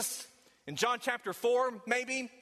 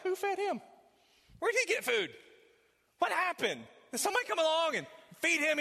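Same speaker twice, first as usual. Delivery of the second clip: much higher, quick baffled questions; puzzled disciples; 8 semitones higher than usual. Acted. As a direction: voice the confused disciples, bewildered.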